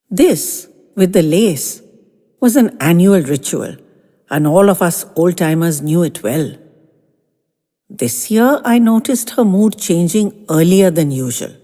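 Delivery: usual speaking voice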